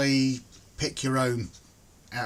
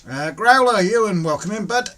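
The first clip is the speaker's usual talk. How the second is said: cheery greeting; 8 semitones higher, 11 dB louder than usual. Real, not acted. Greeting viewers joining the stream.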